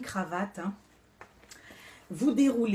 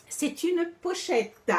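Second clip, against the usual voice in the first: high, slow, drawn-out presentation voice; emphatic show-off; 9 semitones higher, slower than usual. Acted. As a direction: unveil it like a grand showpiece. Présentation théâtrale, puis rire. (présentation théâtrale aiguë et lente)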